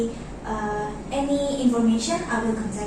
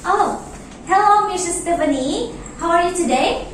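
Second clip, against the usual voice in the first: bright greeting; 6 semitones higher, 4 dB louder than usual. Acted. Teller greets returning customer brightly.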